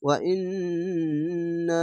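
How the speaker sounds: slow chanted Arabic with long vowels; tajweed recitation, slow and drawn. Shown as a demonstration. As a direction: hold the vowels as in Quran reading. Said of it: "তিন আলিফ টেনে পড়তে হবে".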